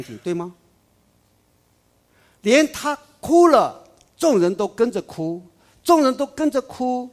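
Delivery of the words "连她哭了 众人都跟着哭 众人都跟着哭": fervent preaching. Real, not acted. Preacher telling the congregation how all wept, fervent and loud. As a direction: build the emotion to a peak. Preacher's climax about weeping.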